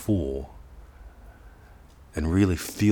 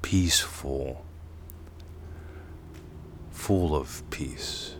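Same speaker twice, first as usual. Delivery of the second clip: slow, soft, whispered and lower; 3 semitones lower, 6 dB quieter, slower than usual. Shown as a demonstration. Teacher savoring the word's feeling, slow whisper. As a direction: let it sink inward slowly. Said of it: "It ends a little abrupt".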